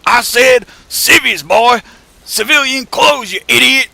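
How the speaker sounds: slow, high, very loud toothless bellow; furious southern boss. Retold or imitated. Old boss corrects the crew over radio, mock furious scream. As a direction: holler each phrase like a fed-up old southerner. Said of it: "the madder he gets".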